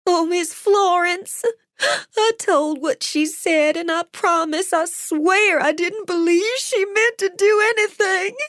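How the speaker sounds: high, tearful babbling; weeping. A narrator's reading. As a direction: babble through sobs. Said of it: "Jolene's fresh weeping".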